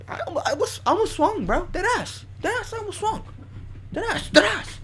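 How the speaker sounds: very high-pitched, incredulous insistence; worked-up, high-voiced boasting; hyped-up bragging. Real, not acted. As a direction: squeal it out in bursts, swearing it's true. Hyped boast about nearly swinging.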